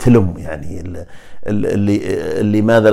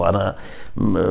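ordinary talk

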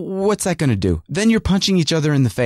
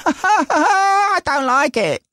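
much higher, slow, laughing whine of "I don't like it"; mock whiny kid; much slower than usual. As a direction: drag out "I don't like it" like a sulky kid, chuckling. Mocking whiny voice of the son.

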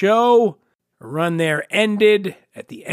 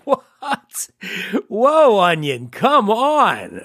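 mock surprise; 4 semitones higher, much slower than usual. Real, not acted. Mock outrage at the headline.

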